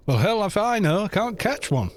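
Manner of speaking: put-on American country twang; awful American accent. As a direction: deliver the punchline in a bad American accent. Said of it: "I'm doing, like, a awful American accent".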